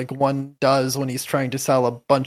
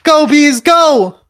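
much higher, shouted cheer; 12 semitones higher, 12 dB louder, slower than usual. Acted. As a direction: cheer the bees on at full voice. Cheering bees on.

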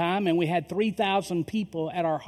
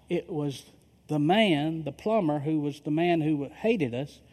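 normal talking voice

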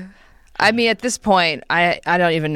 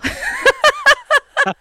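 high, loud giggling burst; a real squeal of laughter; 6 dB louder than usual. Real, not acted. Amused by the fridge list, bursting into high laughter.

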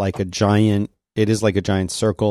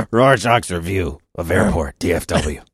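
lightly gruff comic-vigilante read; a hint of terse vigilante narration; Rorschach voice; 3 semitones lower than usual. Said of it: "Rorschach is leaving these".

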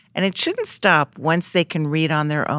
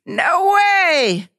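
high, loud defiant cry; high cartoon yell; 4 dB louder than usual. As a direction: shout it high and cheeky. Thief yelling back in comic.

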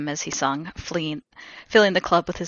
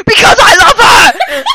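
very high, very loud scream; hammed-up desperate screech; shriek; 17 dB louder than usual. A narrator's reading. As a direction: shriek the confession at full volume. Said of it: "And then Draco shrieked".

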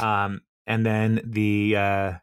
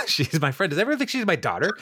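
surprised; 7 semitones higher than usual. Retold or imitated. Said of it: "And he's like".

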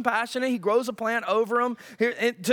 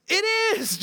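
petulant Jonah; 10 semitones higher than usual. Acted. Jonah answers God petulantly.

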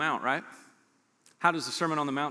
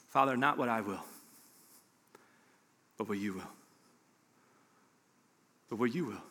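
hushed prayer; 5 semitones lower, 5 dB quieter, faster than usual. Retold or imitated. Quoting Jesus' prayer in garden.

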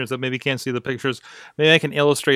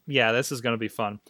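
ordinary talk

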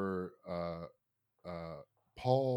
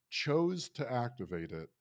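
ordinary talk

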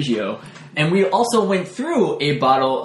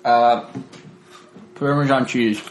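chewing into mic; 2 semitones higher than usual. Real, not acted. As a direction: mouth full, close to the mic. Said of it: "I'm gonna chew this into the microphone".

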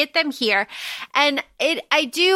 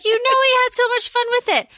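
excited gushing; 10 semitones higher, faster than usual. Real, not acted. Gushing about Haymitch's wig.

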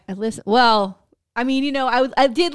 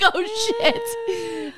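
surprised; 13 semitones higher than usual. Retold or imitated. Realizing with laughter.

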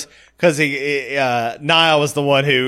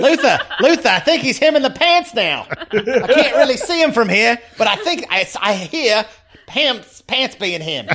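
high, giggly whispered-style report to Luther; the lookout tells Luther about the pants, giggling; silly lookout voice; 9 semitones higher than usual. Pretend. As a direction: play the bumbling lookout on the radio.

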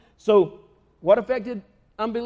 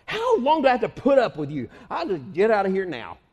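exasperated Jesus; 4 semitones higher than usual. Retold or imitated. Jesus fed up with his followers, exasperated. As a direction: vent that you're done with them. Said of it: "He says".

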